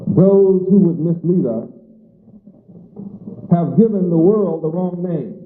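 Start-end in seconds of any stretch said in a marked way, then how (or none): none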